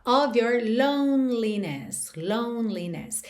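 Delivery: "Of" with a v sound, "loneliness" with a silent middle e; "Of your loneliness" said "ov your lone-li-ness". Shown as a demonstration. Teacher showing "Of" as "ov" and the silent e. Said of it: "sempre com som de O V".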